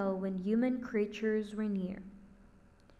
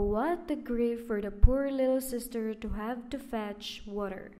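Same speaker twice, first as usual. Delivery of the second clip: ordinary delivery, nothing marked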